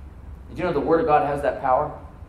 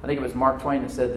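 ordinary talk

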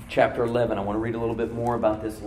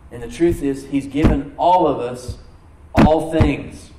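rising, louder preaching on all things; fervent preaching; 2 semitones higher, 5 dB louder than usual. Acted. Pastor preaching God's gifts to the church, fervent and loud. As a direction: preach it out to the congregation. Preacher builds to full force.

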